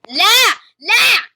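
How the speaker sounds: long loud wail of no; loud drawn-out no. Real, not acted. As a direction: cry out slowly in dismay. Losing in game.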